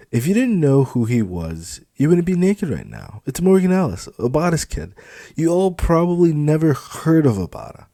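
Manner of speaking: high, loud, condescending; loud scornful reveal; condescending supervisor. Acted. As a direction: talk down to naive recruits. Felix lectures the girls.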